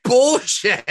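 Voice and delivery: mock outrage, voice shooting high; high indignant scoff. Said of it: "was like".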